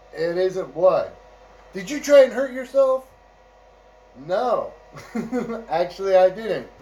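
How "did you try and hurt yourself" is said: high, loud worried question; a guard fearing self-harm, voice jumping up; alarmed guard. Retold or imitated. A guard asking the inmate if he hurt himself, alarmed and high.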